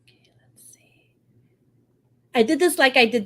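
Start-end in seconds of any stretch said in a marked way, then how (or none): 0.0-1.1 barely audible whisper; absent, whispered muttering; whispered to self. Real, not acted. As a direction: think aloud under your breath. Murmuring to self while checking schedule.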